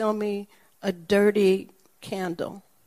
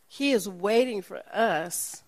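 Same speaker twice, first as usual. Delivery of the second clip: normal talking voice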